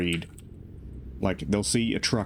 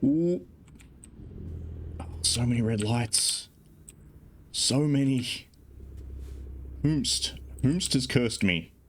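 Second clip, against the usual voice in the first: mock tragic lament; 5 semitones higher, slower than usual. Melodramatic lament at red lights.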